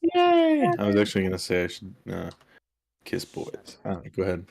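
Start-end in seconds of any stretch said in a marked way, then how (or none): 0.0-0.7 high, bright little cheer; excited cheer. Real, not acted. Cheering the introduced player. 3.0-3.8 whispered aside. Real, not acted. Aside muttered under breath.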